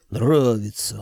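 usual speaking voice

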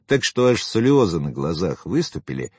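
ordinary delivery

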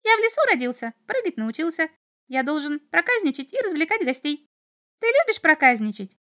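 very high, piping imp voice; playful little devil. A narrator's reading. Little devil introduces himself to the boy, playful. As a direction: chant merrily in a small creature voice. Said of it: "запрыгал чертёнок".

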